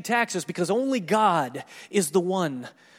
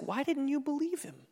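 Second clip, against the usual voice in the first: higher, softer voiced comeback question; Jesus' challenge; 7 semitones higher, 5 dB quieter than usual. Preacher voicing Jesus' imagined reply, lightly higher and softer.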